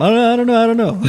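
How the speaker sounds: high-pitched mock panic; flustered, pleading squeal played for laughs; anxious. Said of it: "You get anxiety when people start asking".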